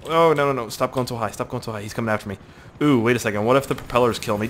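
ordinary talk, nothing marked

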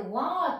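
ワー drawn out after the W; worm vowel sound. Shown as a demonstration. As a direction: sound out ワー as one long vowel. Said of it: "ワーっていう音に変わるので".

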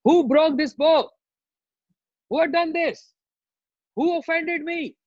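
high-pitched enraged demand; angry shouting. Acted. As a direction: shout it out as a furious warrior sage. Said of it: "And he shouting".

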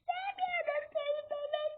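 high wailing fake sob to the baby; mock crying. Player fake-cries at the naughty baby, wailing high.

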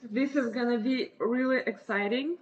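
usual speaking voice

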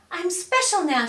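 high, loud proud cry; cried out loud. A narrator's reading. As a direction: call it out proudly. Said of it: "she cried out loud".

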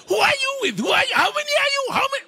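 high, loud yelping questions; scared dog asking. Acted. Preacher voicing the hiding Shenzi dog questioning thieves, high yelping shout. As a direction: bark the questions high like a frightened mutt. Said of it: "he's asking the question from underneath the car".

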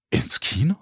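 rising, disbelieving question on KINO; incredulous outburst. A narrator's reading. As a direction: echo "Kino" in disbelief, pitch climbing at the end. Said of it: "explodierte mein Mund".

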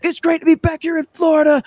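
high, hollered crowd greeting; wrestler promo hype. Retold or imitated. Tag team greets the crowd as the show opens, hyped-up promo.

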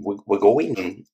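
ordinary delivery

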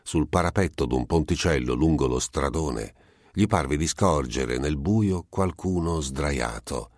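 plain reading voice

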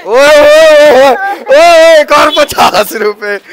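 loud high oye calls; loud triumphant call of oye; triumphant shouting. Real, not acted. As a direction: crow about the money. Announcing collected money loudly.

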